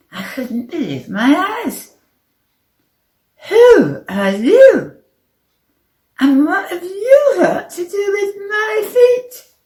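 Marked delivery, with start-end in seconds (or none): 3.5-9.5 bewildered. A narrator's reading. Player asking two little men, bewildered. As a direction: demand answers in high-pitched bewilderment. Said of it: "I asked them both in bewilderment".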